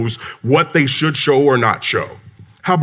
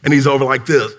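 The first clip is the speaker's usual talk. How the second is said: ordinary talk, nothing marked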